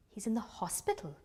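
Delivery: very soft, stunned echo; stunned question. A narrator's reading. Mother echoing the policeman's news, stunned and soft. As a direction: repeat it barely aloud, taking it in.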